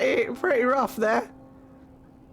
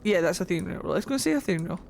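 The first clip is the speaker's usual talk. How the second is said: usual speaking voice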